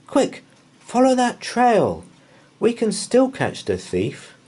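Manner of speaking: put-on high urgent boy voice; eager. Boy urges his dog along the trail, eager and high. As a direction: press the dog on.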